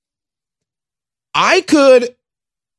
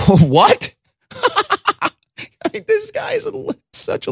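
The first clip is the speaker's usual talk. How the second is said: breaking into high laughter; real disbelieving laugh. Real, not acted. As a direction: laugh in disbelief mid-sentence. Host laughs at Biden tweet.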